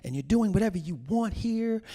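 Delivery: high, loud, scolding mom; nagging mother. Retold or imitated. Mother to daughter who isn't ready, scolding. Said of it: "she is just like".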